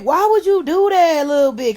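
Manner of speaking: loud, high, exasperated yell; yelling at her. Retold or imitated. Yelling at the cellmate who stank up the cell. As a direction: shout it at the cellmate in disbelief.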